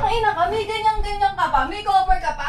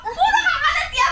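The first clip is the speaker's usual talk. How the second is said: shrill shouted rant, higher, laughing in fury; furious yelling; 7 semitones higher than usual. Acted. Sister shrieking at the girl she pays to school, hysterical angry shout. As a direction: scream it at her, half hysterical. Sister scolding caught teen.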